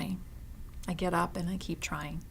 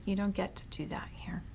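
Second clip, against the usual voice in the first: hushed quote; 7 dB quieter than usual. Retold or imitated. Retold comforting a customer.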